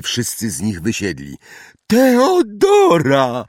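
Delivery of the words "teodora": very high, drawn-out delighted cry; overjoyed shout. A narrator's reading. Neville reunited with his lost toad, overjoyed cry. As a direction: cry out in joyful relief. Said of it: "krzyknął uradowany Neville".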